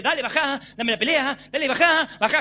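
provocación insistente, más fuerte; diablo provocando. Retold or imitated. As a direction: insiste para sacar al campeón del ring. El diablo provoca desde abajo. (el diablo provoca al campeón, algo más fuerte)

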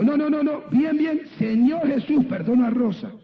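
high, emphatic coaching of a prayer; insistent correction. Retold or imitated. Pastor corrects woman's half-hearted prayer, insistent. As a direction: correct them firmly, pitched well up.

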